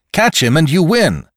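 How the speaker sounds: high, loud, eager instruction; urgent call. Acted. Victim enlists two newcomers to catch his attacker, urgent. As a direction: call them into the chase.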